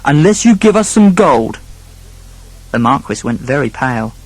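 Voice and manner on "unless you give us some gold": higher-pitched, pointed demand; threatening. A narrator's reading. Eldest son blackmailing the Marquis, threatening. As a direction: press for payment with menace. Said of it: "threatened the eldest son".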